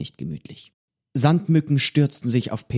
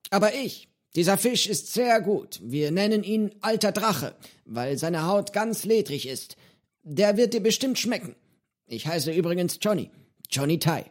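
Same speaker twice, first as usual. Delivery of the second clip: put-on higher, lively old pirate voice; a cheerful eccentric old man offering fish; old pirate Johnny; 8 semitones higher than usual. Old pirate cook to hungry boy, cheerful and high.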